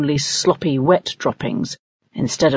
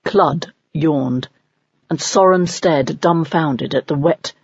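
usual speaking voice

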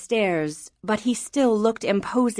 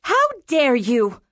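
slow, higher-pitched indignation; outraged cry; 6 semitones higher than usual. A narrator's reading. Mother catches daughter with her letters, outraged cry. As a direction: cry out, scandalized, drawing the words out. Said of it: "Mother cried".

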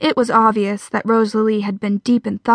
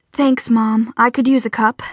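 ordinary delivery

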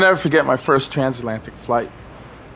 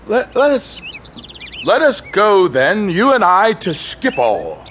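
poetic recitation; 7 semitones higher than usual. Acted. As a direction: declaim it like a poet. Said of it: "quoting a poem grandly".

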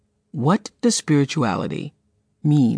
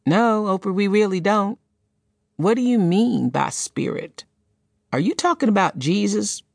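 higher, puzzled voice of a woman in the audience; a lightly voiced audience member asking, pitched up; 7 semitones higher than usual. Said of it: "One woman bravely stood up and said".

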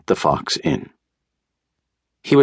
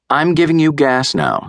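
high, raised doctor voice; doctor instructing patient; 10 semitones higher than usual. A narrator's reading. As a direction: tell the patient in a lifted voice.

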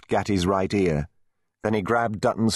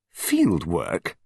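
scornful dismissal; contemptuous. A narrator's reading. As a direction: throw it out with disdain. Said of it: "said Searle contemptuously".

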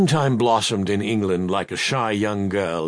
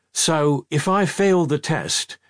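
ordinary delivery

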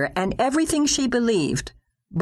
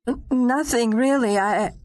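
frightened stammer; 7 semitones higher than usual. Acted. Stammering wife after being questioned.

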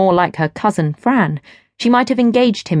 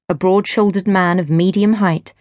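usual speaking voice

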